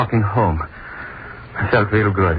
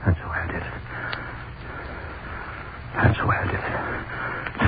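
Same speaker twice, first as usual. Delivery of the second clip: hushed whisper; 6 semitones lower, 8 dB quieter than usual. Acted. Hangman alone after escaping, whispering. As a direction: whisper it to yourself.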